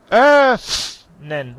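"öffnen" with a long, loud, high ö; exaggerated demonstration. Teacher exaggerates for a student, stretched loud "öffnen". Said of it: "Tú hablas öffnen".

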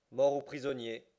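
firm reply. A narrator's reading. Fogg answers the captain firmly.

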